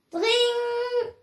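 high, held ringing "dring"; imitated doorbell. Storyteller sounds the bell at Noah's door, ringing imitation. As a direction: ring out like a doorbell on one high note. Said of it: "Es klingelte an der Tür".

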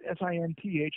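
ordinary talk, nothing marked

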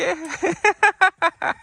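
very high short laugh that falls; real high delighted laugh. Real, not acted. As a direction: burst out laughing at the foal. Laughing at foal's bouncing gait.

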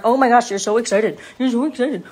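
loud owner cooing at a dog; match the dog's excitement in your own voice; gushing pet talk. Real, not acted. Owner talks back to dog.

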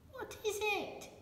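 very high puppet voice, curious. Acted. Puppet asking what the light is, very high. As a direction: squeak the question up high.

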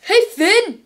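Jake impression. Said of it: "You need to do Jake".

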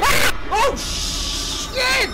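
real shriek of fright at a jump scare; shriek, then curse in shock; startled scream. Real, not acted. Streamer jolted by a jump scare screams.

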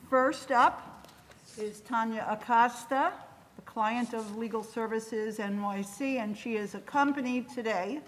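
ordinary talk, nothing marked

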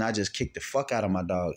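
ordinary delivery

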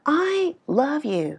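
"I" stretched long and high, slow; stress on I. Shown as a demonstration. Teacher emphasising the subject for learners, stress on I. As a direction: hold "I" up high, then let "love you" drop. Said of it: "mình muốn nhấn mạnh cái chủ thể là mình".

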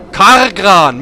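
"Kargran" with strongly pronounced R sounds; slow, loud "Kargran" with exaggerated R; emphatic R. Shown as a demonstration. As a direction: draw out "Kargran", leaning hard on each R. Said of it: "mit R muss man das sprechen".

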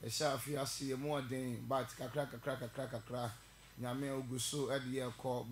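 soft, low prayer voice. Real, not acted. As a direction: pray quietly and humbly before the sermon. Opening prayer, quiet and low.

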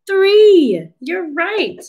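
high, delighted teacher cheer; excited praise. Pretend. Cheering children's correct answer.

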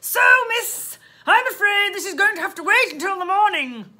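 loud, high dismissal; loud police voice fobbing her off; officious policeman. Acted. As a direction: boom it out officiously.

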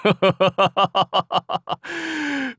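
高く長く響く笑い; 豪快に笑い続ける; 武将の高笑い. Acted. 武将が豪快に笑う. (長く尾を引く高笑い)

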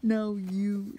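lightly put-on demon voice; hammed-up demonic retort; cringy demonic voice. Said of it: "says in a cringy, ooh, demonic voice".

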